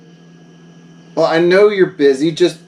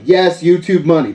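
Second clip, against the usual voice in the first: ordinary talk, nothing marked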